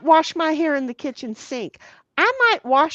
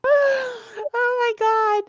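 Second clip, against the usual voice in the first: excited exclaim; 11 semitones higher, much slower than usual. Real, not acted. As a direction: throw it up high, half laughing. Reacting to a funny chat message.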